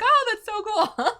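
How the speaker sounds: playful mock delight with a giggle; laughing fake enthusiasm. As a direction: gush through a laugh, pretending to be impressed. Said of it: "And I'm like".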